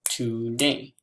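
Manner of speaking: TODAY in two even beats, to, day; TODAY drawn out as to-day; split into syllables. Shown as a demonstration. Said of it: "snap each time I hear".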